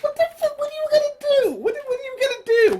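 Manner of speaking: a mock shriek of disbelief at the hero; exasperated disbelief. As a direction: squeal at the absurdity. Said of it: "I'm just like".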